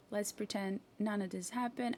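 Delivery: normal talking voice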